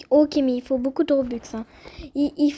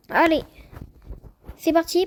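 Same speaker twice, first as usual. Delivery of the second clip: ordinary talk, nothing marked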